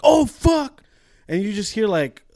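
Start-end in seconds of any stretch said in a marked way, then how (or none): 0.0-0.8 high startled curse from the back room; a sudden high yelp of shock; startled cry. Retold or imitated. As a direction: barber startled by a rat in the back. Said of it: "all you hear is like".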